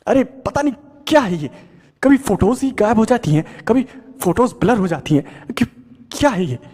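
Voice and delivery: angry shout. Acted. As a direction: blow up at the broken photos. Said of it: "उसने चिल्लाते हुए कहा".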